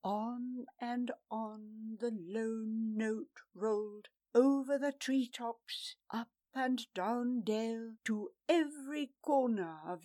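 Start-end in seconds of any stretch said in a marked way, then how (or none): none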